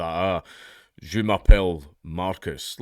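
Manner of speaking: French said slowly in a Scottish accent. Shown as a demonstration. Showing listeners French doesn't work in Scottish, slow Scottish-accented French. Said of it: "speak other languages in a Scottish accent".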